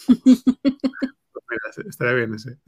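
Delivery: high, slow burst of laughter through the words; laughing. Real, not acted. Amused by the chatbot's joke, laughing through the words. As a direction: crack up, barely getting the words out.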